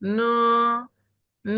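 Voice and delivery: long held ন; Pinyin n as ন. Shown as a demonstration. Teacher answers how n sounds, drawn-out ন. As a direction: draw ন out as a model syllable. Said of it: "এন এর উচ্চারণ হবে কিসের মতো".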